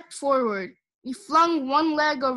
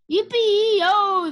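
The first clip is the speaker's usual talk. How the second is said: high, loud, whooping yell; cowboy shout; 8 semitones higher, 7 dB louder than usual. A narrator's reading. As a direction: holler it out from the saddle. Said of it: "the little man shouted".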